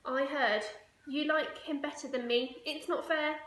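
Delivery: high, loud whiny complaint; a jealous little girl sulking; child's loud whine; sulky child. Acted.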